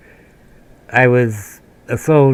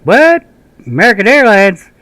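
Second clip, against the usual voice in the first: high, loud disbelieving what; incredulous; 9 semitones higher, 6 dB louder than usual. Retold or imitated. Sergeant scoffing at airline news, high incredulous. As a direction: sergeant can't believe it's American Airlines again.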